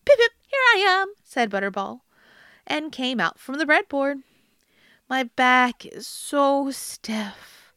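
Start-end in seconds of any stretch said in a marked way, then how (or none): 0.1-1.1 high, loud, quick piping call; high squeaky call. Acted. Boy calls from hiding. 5.1-7.7 slow, drawn-out troll complaint; slow old troll voice. Acted. Troll feigns old age.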